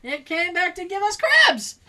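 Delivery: loud, high gleeful yell; laughing shout. Real, not acted. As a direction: shout the punchline with glee. Shouting the callback joke.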